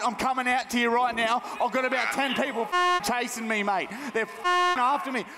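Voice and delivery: high, frantic phone plea; acted panic. Prank caller to cinema worker, high panicked report of being chased. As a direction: gabble as if chased by a mob.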